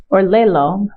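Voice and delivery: normal talking voice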